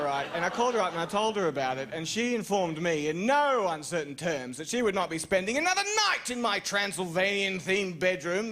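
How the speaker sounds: ordinary delivery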